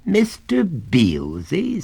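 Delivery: slow, high rising disbelief on Beelzy; inquiring. Acted. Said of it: "raising his eyebrows inquiringly".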